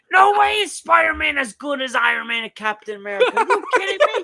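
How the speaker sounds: high, loud sports-radio rant; Mad Dog impression. Podcaster mimics a sports host ranting about Spider-Man, loud and high. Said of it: "he'd be like".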